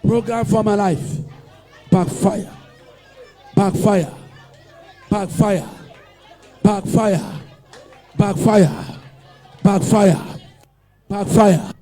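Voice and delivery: loud, chanted repeated decree; shouted prayer. Acted. Preacher leading the church against shame, loud chanting prayer. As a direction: drive each backfire home.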